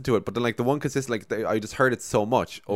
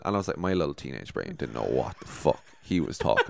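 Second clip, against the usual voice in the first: normal talking voice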